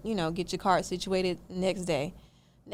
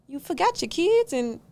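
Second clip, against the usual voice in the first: high, disbelieving question; incredulous; 10 semitones higher, 4 dB louder than usual. Real, not acted. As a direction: ask in disbelief. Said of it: "incredulous reaction".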